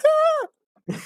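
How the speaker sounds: very high imitation squeal; short hammed-up high shriek; mock scared scream. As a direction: squeal as if badly scared, for laughs. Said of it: "And Eva Marie".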